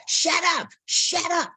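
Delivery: high, mock angry yell; hollering at the dog. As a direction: snap at the barking dog. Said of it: "he began to holler at the dog".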